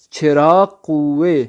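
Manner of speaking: چراغ قوے very slow and loud, "chhe-raagh ghu-ve"; Persian pronunciation. Shown as a demonstration. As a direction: draw out چراغ قوے syllable by syllable with aspirated ch. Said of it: "فارسی میں کیا کہیں گے". (چراغ قوے فارسی تلفظ میں، کھینچ کر، بلند آواز)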